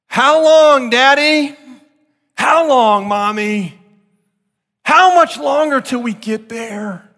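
put-on high, pleading child's whine; whiny kids in back seat. Preacher imitating his kids whining on a long drive, high and pleading. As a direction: mimic a tired kid begging from the back seat.

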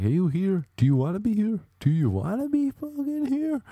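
shrill high yelling fitness coach; yelling instructor. Retold or imitated. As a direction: bark it like a hot yoga drill instructor. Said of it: "He was like".

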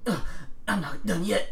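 normal talking voice

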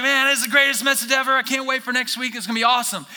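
high, loud gushing praise; gushing fan. Retold or imitated. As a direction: rave like an eager fan. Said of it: "you're gonna be like".

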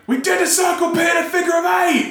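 high, strained yell over a crowd; mock crowd holler, pitched high; retold shouting. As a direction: re-enact shouting up at the stage. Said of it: "just started shouting".

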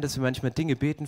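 ordinary delivery

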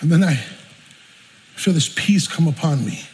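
usual speaking voice

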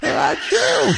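big high-pitched sneeze; loud sneeze. Acted. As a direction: let out a huge sneeze. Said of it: "a loud sneeze".

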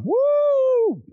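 high falsetto whoop; mock cheer. Imitating the wrap-party crowd, high whoop. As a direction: whoop like the cast cheering each credit.

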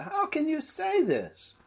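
exasperated Jesus. Acted. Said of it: "trying to show the mood of his questioning".

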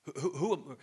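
normal talking voice

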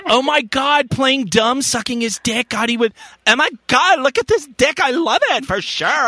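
high-pitched mock Valley Girl; Valley Girl accent. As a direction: put on an exaggerated Valley Girl voice. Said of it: "like, Valley Girl accent to it".

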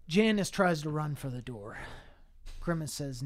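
usual speaking voice

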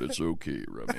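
very low, soft big-man rumble; deep, hushed imitation of a big man consoling; booming voice. Big MC in a tux comforting the comic, deep-voiced. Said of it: "he has booming voice".

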